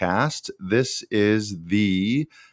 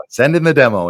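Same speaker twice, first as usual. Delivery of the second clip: fast, louder, bright-eyed urging; eager push; 5 semitones higher, 8 dB louder, faster than usual. Real, not acted. Host cheering a listener to send his demo, fast and louder. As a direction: hype the listener into sending it.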